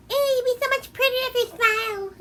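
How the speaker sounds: very high, loud sneering imitation; mocking a guy who tells women to smile; mocking creep. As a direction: parody a creepy guy's catcall. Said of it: "guys tell you to fucking smile".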